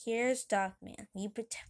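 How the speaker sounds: usual speaking voice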